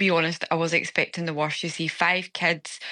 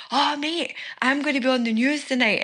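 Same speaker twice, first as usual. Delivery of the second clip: put-on excited kid; 7 semitones higher than usual. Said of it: "he's like".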